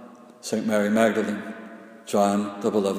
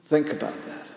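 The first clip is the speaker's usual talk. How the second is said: whisper; 5 semitones higher, 4 dB quieter, faster than usual. Shown as a demonstration. Hushed repeat after the cry.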